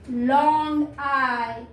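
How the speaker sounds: लॉन्ग आई at half speed, both stretched; long I. Shown as a demonstration. Teacher explaining the open syllable, लॉन्ग आई slowly stretched. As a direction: draw out लॉन्ग आई. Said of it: "इसलिए इसको हम पढ़ेंगे".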